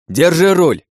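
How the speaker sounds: loud, high, urgent order; shouted command. A narrator's reading. Driver hands princess the wheel mid-chase, shouting. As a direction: shout it as a split-second command.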